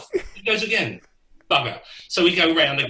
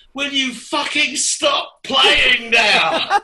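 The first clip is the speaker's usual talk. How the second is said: slow, drawn-out shout, much louder and higher; furious yell; 11 semitones higher, 7 dB louder, much slower than usual. Retold or imitated. Bandmate yelling at the drummer who won't stop, exasperated shout. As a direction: stretch out every word in an exasperated yell.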